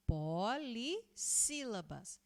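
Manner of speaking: slow, stretched syllables; drawn-out term. Shown as a demonstration. Teacher introducing the next word class to pupils, slowly drawn out. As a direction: stretch out each part of "Polissílaba".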